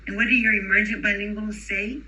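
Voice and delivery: normal talking voice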